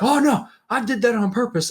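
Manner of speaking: high put-on voice of denial; mock defensive excuse. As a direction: imitate someone covering up a fall. Man excusing his fall, acted.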